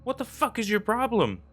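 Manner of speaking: high, pissed-off shout; angry shout. A narrator's reading. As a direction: snap at him, angry and scared. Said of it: "Stacy shouted at him, pissed but also scared".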